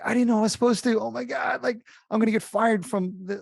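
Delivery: high-pitched retold panic; panicking. Rookie host retelling fear of being fired, panicking. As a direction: voice shoots up, flustered and alarmed. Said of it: "I was panicking".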